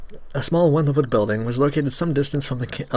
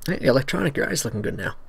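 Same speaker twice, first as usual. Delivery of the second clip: ordinary talk, nothing marked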